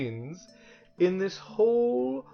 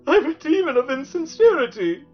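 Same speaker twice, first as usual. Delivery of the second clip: very high, loud theatrical wail; sobbing; 14 semitones higher, 9 dB louder than usual. A narrator's reading. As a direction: wail it out in hammed-up sobs. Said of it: "he sobbed".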